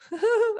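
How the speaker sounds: a quick high laugh; short embarrassed high laugh. Real, not acted. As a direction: laugh at how dramatic that sounded. Laughs at own dramatic phrase.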